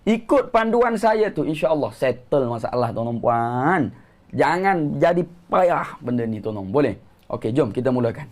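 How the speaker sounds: normal talking voice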